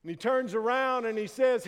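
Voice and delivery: normal talking voice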